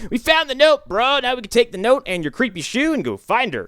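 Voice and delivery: a hammed-up buddy bragging; bro voice. Buddy telling the hero about the note, high bro voice. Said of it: "tell him, like".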